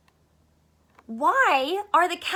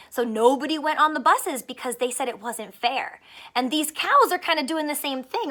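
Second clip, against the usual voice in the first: plain reading voice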